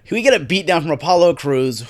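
usual speaking voice

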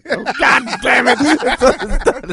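high, cracking up mid-curse; laughing exclamation. Real, not acted. Friend giving up on the beatbox, high laughing outburst. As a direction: give up through helpless laughter.

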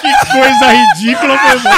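shrieking with laughter; high screaming laugh while talking; laughing hard. Real, not acted. Cracking up after the prank.

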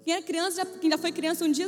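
ordinary talk, nothing marked

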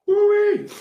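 high, loud woo-wee; excited whoop. Real, not acted. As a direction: whoop from the heat. Reaction to spicy heat.

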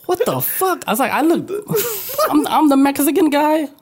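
high, indignant what the fuck; high-pitched comic outrage; mock outrage. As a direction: squeak out stunned disbelief. Retold shock at being called Mexican.

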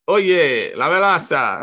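high, loud Spanish call to a teammate; imitated clubhouse call-out. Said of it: "speaking Spanish to him".

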